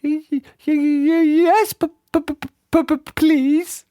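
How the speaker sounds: high, trembling stammer; quivering. A narrator's reading. Mister Jelly accepting the tramp's secret, quivering and stammering. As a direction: stutter each word out in squeaky fear. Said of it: "quivered and shook and said".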